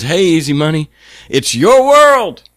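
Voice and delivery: high holler building to a loud, stretched finish; imitated street yell. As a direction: shout it to someone driving past, like the guy on the corner. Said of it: "he yells out and he goes".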